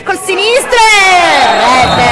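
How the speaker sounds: high, slowed goal announcement; italian football commentary. Real, not acted. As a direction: build to the goal and shout it. Goal call in live match commentary.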